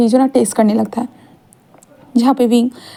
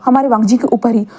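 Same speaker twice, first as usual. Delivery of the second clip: ordinary delivery, nothing marked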